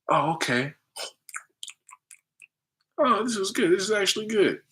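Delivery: hammed-up polite enjoyment; mock pleasant surprise. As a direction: act like it's actually good. Pretending to enjoy food.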